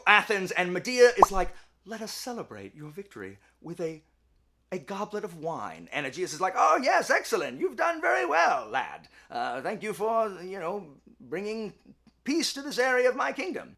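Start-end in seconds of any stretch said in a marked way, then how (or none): 1.9-5.9 soft, hushed, low offer; sly hushed voice, offering a drink; quietly insinuating character voice; scheming Medea. Retold or imitated. Voicing Medea offering poisoned wine. 6.6-13.8 hammed-up kingly approval; mock-regal thanks, a bit halting; King Aegeus. Voicing King Aegeus praising Theseus.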